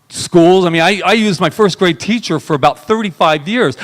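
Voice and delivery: ordinary talk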